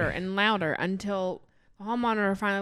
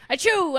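loud fake sneeze; hammed-up achoo; 7 semitones higher, 6 dB louder than usual.

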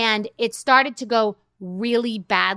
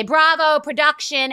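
calling out to crew; 6 semitones higher, 5 dB louder, slower than usual. Retold or imitated. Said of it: "Denise Richards at a dinner could say".

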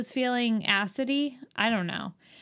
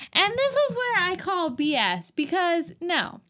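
high-pitched indignant rant; indignant objection; 8 semitones higher than usual. Real, not acted. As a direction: call out the nonsense, pitched high. Ranting at an implausible movie scene.

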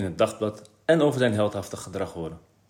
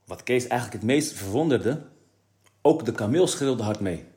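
plain reading voice

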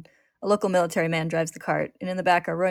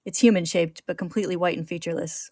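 ordinary delivery

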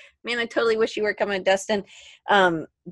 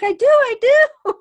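high, loud and slow, eager; flipped out; 8 dB louder, slower than usual. Retold or imitated. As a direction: insist eagerly like a giddy fan. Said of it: "I totally flipped out".

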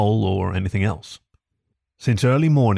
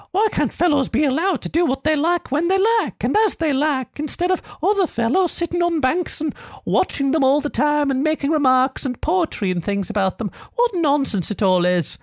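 much higher, put-on duck voice; grumbling ducks. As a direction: play the ducks as huffy little busybodies. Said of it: "They say".

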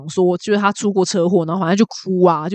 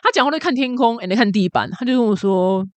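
ordinary talk

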